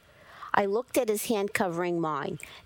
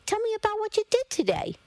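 eager prodding; 13 semitones higher than usual. Acted. Mother pressing son for news.

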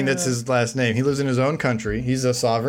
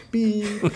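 censor bleep noise; 9 semitones higher than usual. Retold or imitated.

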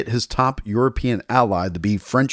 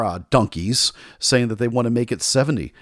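ordinary talk, nothing marked

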